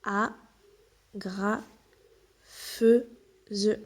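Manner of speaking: A, gra, feu, ze spelt out beat by beat with pauses; spoken syllable by syllable for a child. Shown as a demonstration. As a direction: split the word into slow separate syllables. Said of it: "Ensuite, nous avons une".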